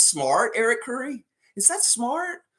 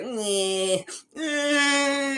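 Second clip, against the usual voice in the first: mock coach shrug; 9 semitones higher than usual. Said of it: "the way he's like".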